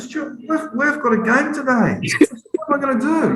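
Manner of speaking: high, whiny mock despair; mock panic. Telling his husband he has a game today, high hammed-up panic. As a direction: wail it like a hammed-up crisis.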